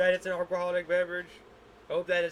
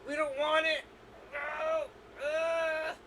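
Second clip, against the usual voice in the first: body voiced; 10 semitones higher, much slower than usual. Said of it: "and it's like".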